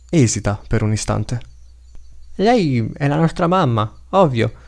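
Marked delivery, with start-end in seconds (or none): 2.4-4.5 put-on high, drawn-out childlike voice; young child. A narrator's reading. Child introducing the mother, in a child's voice. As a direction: state the obvious as a child would. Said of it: "dice un bambino".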